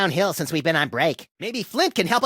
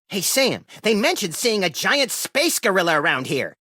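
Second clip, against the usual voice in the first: ordinary delivery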